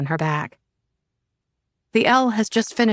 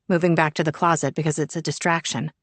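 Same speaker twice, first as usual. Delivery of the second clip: usual speaking voice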